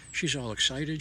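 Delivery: ordinary delivery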